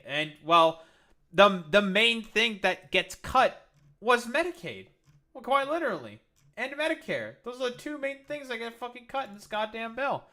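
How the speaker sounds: loud, exasperated outburst, pitch climbing high; angry rant. Real, not acted. Streamer raging at viewers about the bill, loud angry outburst. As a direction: shout it out in disbelief and fury. Ranting at Trump's Medicaid cuts.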